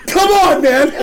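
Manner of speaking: high-pitched comic plea; retold exasperated appeal. Teller appealing to manager, high exasperated retold plea. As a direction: reenact pleading in disbelief.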